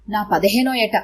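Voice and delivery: ordinary delivery, nothing marked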